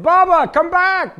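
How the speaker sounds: high, loud drawn-out call across a distance; yelling. Retold or imitated. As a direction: yell after someone running away. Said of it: "they're yelling at him".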